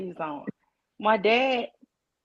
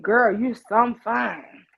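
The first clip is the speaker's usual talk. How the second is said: imitating the man; 4 dB louder, much slower than usual. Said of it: "And said".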